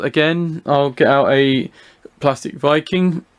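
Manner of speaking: normal talking voice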